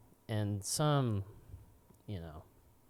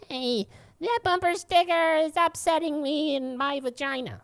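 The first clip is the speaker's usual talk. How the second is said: high, loud mocking whine; mocking impression of an upset stranger; mocking whiny liberal; 6 dB louder than usual. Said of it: "total liberal fuckwad".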